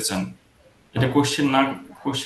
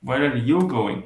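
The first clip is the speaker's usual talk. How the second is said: "you" lifted, "going" drops; emphatic "you" in the question; stress on YOU; much slower than usual. Shown as a demonstration. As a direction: put the weight on "you", then fall on "going". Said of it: "জোরটা হচ্ছে you-তে".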